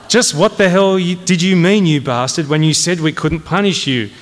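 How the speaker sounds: lightly voiced clerk echoing the colonel's outburst; corporal reading back. Corporal reading the colonel's question from shorthand, forcefully. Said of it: "reading from his steno pad".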